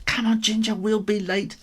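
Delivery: high, loud hurried mum; mum's voice. A narrator's reading. Mum rushing Ginger to school, high and loud. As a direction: hurry the kitten out the door. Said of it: "His mum's going".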